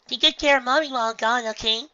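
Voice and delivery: high, loud little filly; cheerful filly voice. A narrator's reading. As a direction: ask it brightly. Filly farewell.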